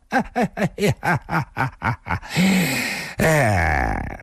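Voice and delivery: cackling laugh. A narrator's reading. Drunk intruder chortling at the woman he found, cackling. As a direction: cackle in bursts, sliding down in pitch. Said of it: "咯咯地笑道".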